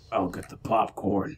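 slow, low character line; game quote. Retold or imitated. Friend quoting a favorite Gears line, low and slow. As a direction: drop low and drag it out. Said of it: "my favorite one from the".

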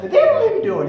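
very high, loud comic squeal; exaggerated high-pitched comedy catchphrase; Geraldine impression. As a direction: shriek the excuse in a falsetto woman's voice. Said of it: "He used to do this with this high-pitched voice".

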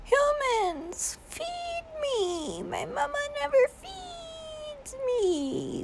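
high squeaky voice for the dog. Pretend. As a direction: squeak it out like a begging puppy. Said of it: "He's just like".

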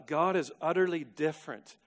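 ordinary talk

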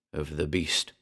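ordinary delivery

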